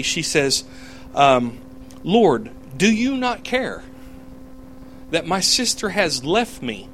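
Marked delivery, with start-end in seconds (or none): 1.1-6.9 slow, high-pitched reproach to the Lord; Martha's pointed complaint, pitched up and slow; confronting. Acted. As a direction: confront him with drawn-out, rising indignation. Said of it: "She confronts the Lord".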